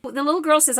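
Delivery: normal talking voice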